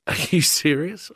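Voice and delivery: can't believe what you're hearing; disbelief. Retold or imitated. Disbelief at official.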